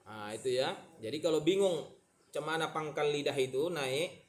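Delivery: ordinary talk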